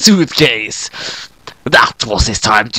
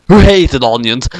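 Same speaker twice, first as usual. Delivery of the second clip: usual speaking voice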